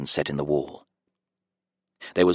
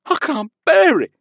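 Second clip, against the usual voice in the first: terrified; 7 dB louder than usual. A narrator's reading. Prisoner keeps up terrified act.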